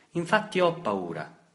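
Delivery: high, loud frightened cry; scared admission. A narrator's reading. Boy to father, frightened cry. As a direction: admit the fear with a cracking voice. Boy admits being afraid.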